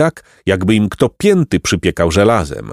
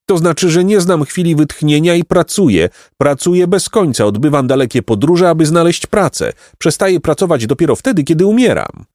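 proud, busy bee; 8 semitones higher than usual. A narrator's reading. Voices the hardworking bee.

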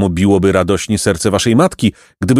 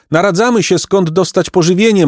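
high, lifted calling voice; lightly projected, high-pitched reply; calling aloud; 11 semitones higher than usual. A narrator's reading. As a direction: call it out much higher. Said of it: "a głośno zawołał".

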